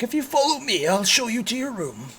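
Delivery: high-pitched servant's voice; Corwin character voice. Acted. Corwin leads Strike to his room, high-pitched host voice.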